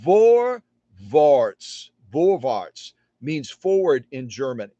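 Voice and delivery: German vorwärts; German word vorwärts. Shown as a demonstration. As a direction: model vorwärts for the class. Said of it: "So var".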